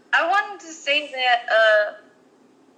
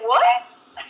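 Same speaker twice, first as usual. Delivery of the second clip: high shocked squeak of disbelief; confused what. Retold or imitated. Daughter reacting to mom's news, confused squeak. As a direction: yelp it out, totally baffled. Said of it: "I was confused".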